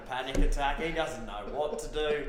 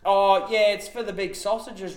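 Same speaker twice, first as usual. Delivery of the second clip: mock earnest tease; 6 semitones higher, 7 dB louder than usual. Mates teasing Ray loudly.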